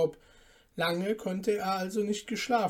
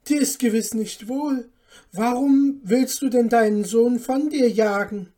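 higher, louder, dismayed protest; shocked appeal; 5 semitones higher, 10 dB louder than usual. A narrator's reading. Son asking his mother why she rejects him, shocked appeal. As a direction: appeal to them in alarm. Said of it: "fragte Jakob ganz erschrocken".

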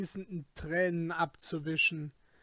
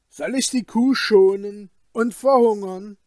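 loud, raised-pitch outburst; Wilm's frantic shout; 4 semitones higher, 10 dB louder than usual. Acted. Wilm, axe still raised over the cow, crazed shout. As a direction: shout the question back wildly. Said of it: "schrie Wilm wie ein Wahnsinniger".

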